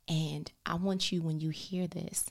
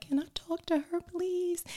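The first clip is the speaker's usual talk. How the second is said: much higher, sugary polite ask; sweet phone request; 13 semitones higher than usual. Retold or imitated.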